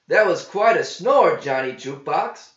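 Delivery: mock John for snoring; teasing companion. A narrator's reading. Gear teases waking John.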